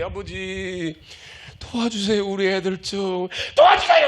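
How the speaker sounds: rising, earnest cry of 도와주세요; pleading prayer. Shown as a demonstration. Preacher showing the congregation how to cry out, pleading. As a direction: beg like a parent crying out for help. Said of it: "여러분, 기도할 때".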